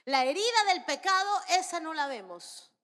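loud, high declaration; forceful pulpit emphasis; emphatic preaching. Real, not acted. Preacher stresses key point.

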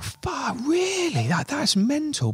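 high, drawn-out incredulous reaction; shocked disbelief. Real, not acted. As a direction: gasp it out, can't believe it.

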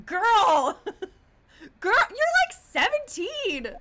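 disbelieving yell at the screen; loud, high, laughing exclamation; incredulous outburst. Real, not acted. Reacting to a game character.

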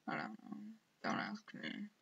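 breathy aside to himself, whispered. Real, not acted. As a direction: mutter it under your breath in a whisper. Whispered self-answer.

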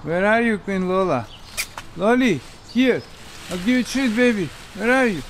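high, loud coaxing calls; calling a dog. Real, not acted. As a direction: coax the dog out with a treat. Calling hidden dog with treats.

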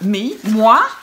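loud, exclaimed disbelief; exclamatory. Shown as a demonstration. Mary answering the angel, in a loud exclamatory voice. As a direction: burst out the words in disbelief. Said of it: "a more exclamatory voice than that".

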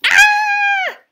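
high, loud scared shriek; scream. Acted. Triangle shrieks at the shape in the dark: loud scared scream. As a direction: shriek in sudden fright. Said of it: "said Triangle".

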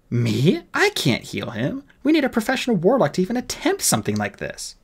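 protesting fairy, climbing high; fairy captain. Acted. Fairy refusing the boy's plea, high-pitched protest. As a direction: protest that it's beyond you, pitch rising.

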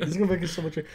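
usual speaking voice

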